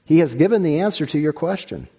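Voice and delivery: normal talking voice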